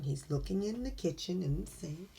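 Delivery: ordinary delivery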